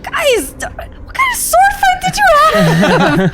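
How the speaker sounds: very high, loud, strained outburst; shocked yelling. Acted. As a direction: yell in hammed-up disbelief. Character bursts in on wrecked bathroom.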